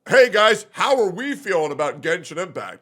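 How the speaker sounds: loud mock YouTuber greeting; mocking quote voice. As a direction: parody a bait tweet. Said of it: "this feels like a Doro forty-four tweet".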